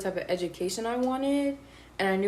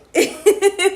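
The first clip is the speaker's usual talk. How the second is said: loud, high burst of laughter; real laugh, pitched high and loud; 11 semitones higher, 9 dB louder than usual. Real, not acted. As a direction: crack up at the memory. Laughs at mom's reply.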